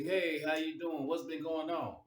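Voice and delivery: mock the fake smile at the family gathering; fake friendly greeting. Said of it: "the fake handshakes".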